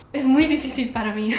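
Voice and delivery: fast, loud and laughing. Real, not acted. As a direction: laugh it off quickly. Admits Spanish is hard.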